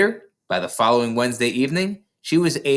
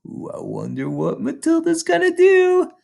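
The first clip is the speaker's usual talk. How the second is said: eager wonder. Real, not acted. Narrator teases listening children about what comes next, high and eager.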